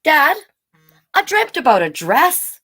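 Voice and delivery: put-on child telling mother about a dream. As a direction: sound like a hopeful young child. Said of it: "Bailey said".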